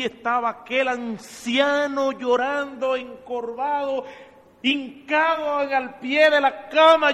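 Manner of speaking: loud, high, slow preaching build; impassioned preaching. Acted. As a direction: paint the weeping old man with fervor. Preacher tells of the old man weeping.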